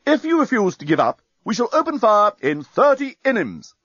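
loudspeaker voice. Acted. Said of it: "said the voice from the enemy".

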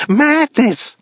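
very high crazed cry; screamed by a madman. Acted. Madman recalling the doomed detour, high crazed scream. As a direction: let the word out as a high mad shriek. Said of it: "He screamed".

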